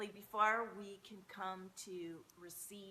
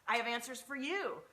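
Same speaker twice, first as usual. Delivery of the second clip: loud, high gushing over a soulmate; gushing delight; 3 semitones higher, 8 dB louder than usual. Pretend. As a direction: swoon loudly at your soul family. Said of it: "and you're just like".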